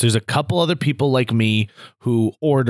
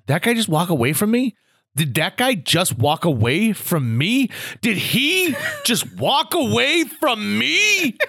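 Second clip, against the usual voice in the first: hammed-up outrage breaking into laughter; mock indignation; 8 semitones higher than usual. Mimicking affronted man, escalating each repeat.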